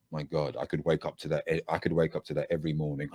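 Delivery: low, soft, swooning murmur; mock swoon over a voice; breathless admirer. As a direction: sigh it out like you're smitten. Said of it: "she went".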